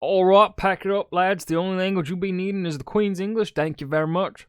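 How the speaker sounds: put-on a half-hearted pompous Englishman in a British accent; mock British accent. Co-host mocking British officials in a drifting British accent. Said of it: "I don't know what accent that turned into".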